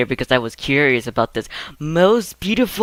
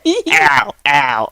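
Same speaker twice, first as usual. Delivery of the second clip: pained yelps; 11 semitones higher, 5 dB louder than usual. Acted. Duck attack.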